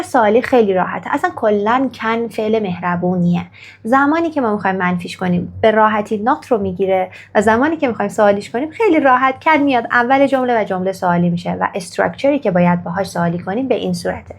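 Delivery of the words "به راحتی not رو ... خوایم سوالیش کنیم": ordinary delivery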